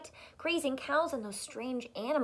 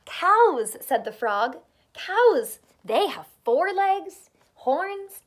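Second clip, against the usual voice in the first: much higher, louder marvelling frog; excited frog; 7 semitones higher, 12 dB louder, slower than usual. Acted. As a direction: sell the wonder of cows. Frog excitedly describes cows.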